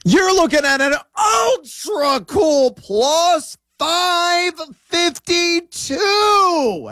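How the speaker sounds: high, drawn-out hype announcer; announcer shout. Acted. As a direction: stretch the odds out like a ring announcer. Announces the parlay odds as showman.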